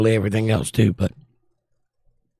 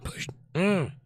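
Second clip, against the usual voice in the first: voice cracking; 6 semitones higher, 9 dB quieter than usual. Real, not acted. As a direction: voice breaks high and fails. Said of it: "My voice just will not work".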